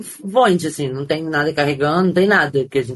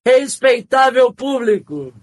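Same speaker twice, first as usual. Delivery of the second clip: booming circus ringmaster announcement; slow, grand ringmaster shout with a laugh; 7 semitones higher, 4 dB louder, much slower than usual. Retold or imitated.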